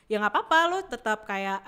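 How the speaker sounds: usual speaking voice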